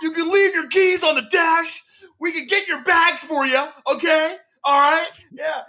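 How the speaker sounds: very high, stiff customer-service voice; mock supervisor. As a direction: parody the awkward supervisor's script, very high. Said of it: "he's like".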